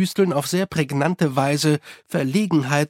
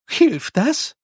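puzzled, higher-pitched question; confused; 10 semitones higher, 4 dB louder than usual. A narrator's reading. Unity asks about the rule on underwear, confused. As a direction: ask it as if lost. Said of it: "erkundigte sich Unity verwirrt".

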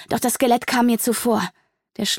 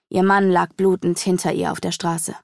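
ordinary delivery, nothing marked

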